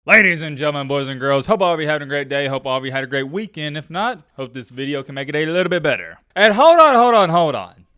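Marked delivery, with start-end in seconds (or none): 0.0-1.5 booming host welcome; grand entrance announcement. Acted. Show opener announcement. 6.4-7.9 much higher, louder hold on; loud interruption. Real, not acted. Interrupts himself loudly.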